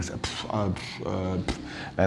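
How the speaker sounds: soft unvoiced French puffs. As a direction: puff air out softly like a shrugging Frenchman. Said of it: "francês bufa".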